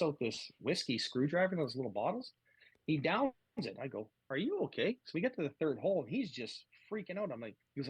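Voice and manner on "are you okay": higher-pitched retold question of concern; concerned check. Caddy asks his panicking golfer, concerned. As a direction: imitate checking on someone in a panic.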